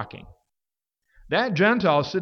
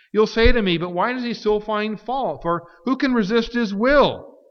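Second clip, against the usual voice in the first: challenging objection; 7 semitones higher, 6 dB louder than usual. Retold or imitated.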